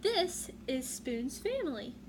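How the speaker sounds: plain reading voice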